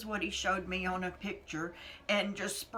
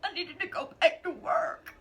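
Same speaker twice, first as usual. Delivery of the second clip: tearful whisper; 9 semitones higher, 4 dB louder than usual. Real, not acted.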